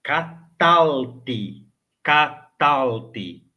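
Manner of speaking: catalte slowly, ka-TAL-ti, no vowel after L; short catalte. Shown as a demonstration. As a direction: spell out catalte beat by beat with a clear final I. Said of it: "Muitos vão pronunciar também".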